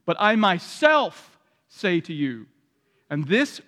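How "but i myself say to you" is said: slow, weighted "myself"; stress on myself. Shown as a demonstration. Preacher stressing Jesus' claim slowly. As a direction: land hard on "myself". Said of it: "The Greek is emphatic".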